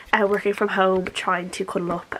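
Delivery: normal talking voice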